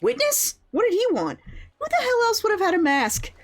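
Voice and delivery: very high-pitched incredulous outburst. Acted. Investigator questions who the masked visitor was, high incredulous outburst. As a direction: shoot the pitch way up in disbelief.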